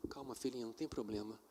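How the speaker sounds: calm father reassuring; gentle comfort. Retold or imitated. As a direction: soothe the little girl.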